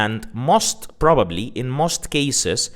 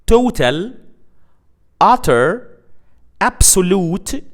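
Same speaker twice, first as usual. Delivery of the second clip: each word punched higher and louder; stressed examples: "total", "utter", "absolute", each pitched up with a rise; emphatic list words; 6 semitones higher, 5 dB louder than usual. Shown as a demonstration. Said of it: "Adjectives like complete".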